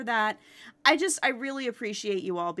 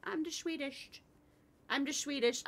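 higher, softer cute dog voice; voicing the dog; 6 semitones higher, 7 dB quieter than usual. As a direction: brag sweetly as the little dog. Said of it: "You tell them".

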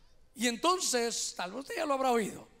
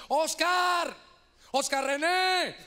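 drawn-out shouted name, higher pitched; calling out; 6 semitones higher, 5 dB louder, much slower than usual. Retold or imitated. Brother calls to his unborn son, calling out loudly. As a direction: holler the name slowly, stretching it out. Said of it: "empezó a gritarle".

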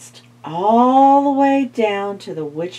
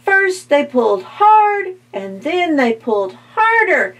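building excitement; 10 semitones higher, 5 dB louder than usual. A narrator's reading. Climax of the big pull.

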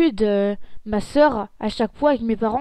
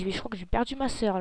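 ordinary talk

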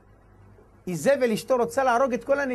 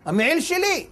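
high-pitched protest; aggrieved son arguing; insistent claim; 7 semitones higher than usual. Acted. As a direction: stake your claim indignantly. Son claims the coat, quarrel.

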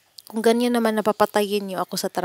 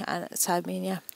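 ordinary talk

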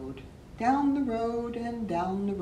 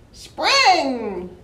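groundhog voice; 7 semitones higher, 12 dB louder than usual. Acted. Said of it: "said the groundhog".

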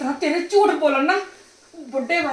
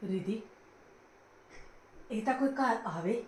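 much softer, lower reasoning; soft appeal; 6 semitones lower, 13 dB quieter than usual. Acted. Mother-in-law softly reasoning with Ridhi. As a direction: hush and reason with her.

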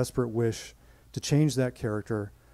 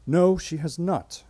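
slow, clipped denial, a touch higher; terse; 6 semitones higher, 4 dB louder, slower than usual. Retold or imitated. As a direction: bite off each word. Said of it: "he responded tersely".

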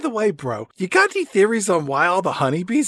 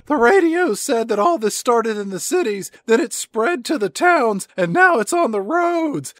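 soldier voice; 6 semitones higher than usual. Acted. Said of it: "Private Dead Meat says".

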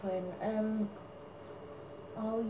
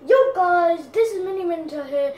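higher, louder, fast hyped YouTuber intro; Mini Minter impression; 3 semitones higher, 5 dB louder than usual. Making up the YouTuber's intro, hyped and fast. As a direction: hype up the viewers at speed. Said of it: "I'm gonna do an impression of him".

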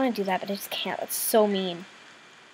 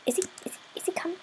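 tense hushed whisper; 21 dB quieter, much faster than usual. Real, not acted. Player hiding from the murderer, whispering. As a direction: breathe the words so the killer won't hear.

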